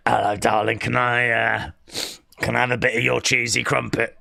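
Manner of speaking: low, cheeky put-on chat-up voice; James impression. Streamer as James asking for cheesy crumpet, low voice. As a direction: drop the pitch and ask saucily. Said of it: "That's my James impression".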